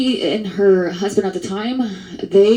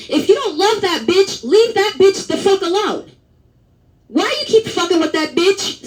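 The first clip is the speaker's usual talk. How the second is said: higher-pitched, fired-up rant about fake givers; heated rant; 10 semitones higher than usual. Real, not acted. Narrator ranting at donors, voice climbing high. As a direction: let it rip, voice climbing.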